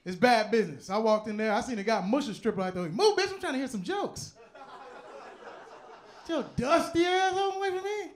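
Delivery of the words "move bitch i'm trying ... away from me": high-pitched indignant yelling; mock outraged squawk; annoyed shouting customer. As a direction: shove someone off with a high holler. Acted heckler shoving a stripper away.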